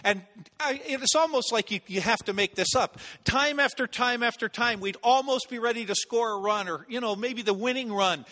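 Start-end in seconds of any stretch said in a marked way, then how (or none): none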